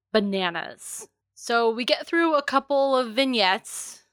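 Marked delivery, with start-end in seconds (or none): none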